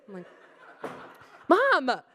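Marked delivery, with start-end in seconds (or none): 1.5-2.0 high, loud cry to mom; acted scolding holler; exasperated shout. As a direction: yell to make her stop.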